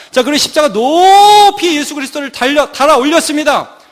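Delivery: urgent, forceful sermon narration; impassioned crucifixion account; full-force preaching. Real, not acted. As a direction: drive each blow home from the pulpit.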